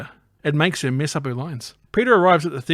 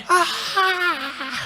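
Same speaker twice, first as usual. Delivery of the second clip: high falling-hero scream; mock scream. Spider-Man falling onto pipes, mock high scream. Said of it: "He's like".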